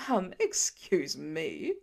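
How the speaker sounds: slow, low, hammed-up offence; mock insulted. A narrator's reading. Dad hamming it up to make Pete smile, mock insulted. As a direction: play the wounded artist for laughs. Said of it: "mock insulted hand on his chest".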